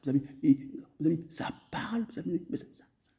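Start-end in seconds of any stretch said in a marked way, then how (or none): none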